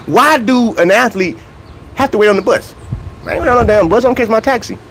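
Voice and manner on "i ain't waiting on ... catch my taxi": normal talking voice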